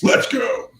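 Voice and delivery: usual speaking voice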